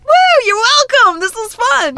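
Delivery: high, loud happy cheer; excited whoop. Real, not acted. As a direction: whoop and sign off brightly.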